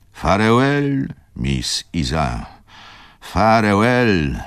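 long, drawn-out high farewell call. A narrator's reading. Wokulski calling to Izabela on a leaving train, drawn-out cry. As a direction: stretch each farewell out, slowly, like hailing a train. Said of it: "zawołał Wokulski".

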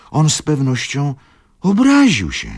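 climbing high and loud in alarm; alarmed outburst. A narrator's reading. Tomasz cries out in alarm to Izabela. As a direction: panic rising to a cry. Father panics at the news.

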